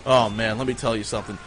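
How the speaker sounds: ordinary talk, nothing marked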